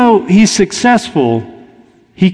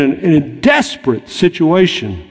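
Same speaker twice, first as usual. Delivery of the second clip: ordinary delivery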